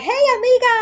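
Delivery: high, loud friendly hello from the big guy; cheerful greeting. Retold or imitated. Big guy greets her again.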